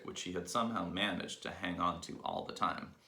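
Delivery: usual speaking voice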